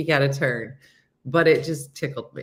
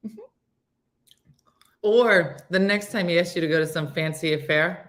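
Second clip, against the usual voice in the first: ordinary talk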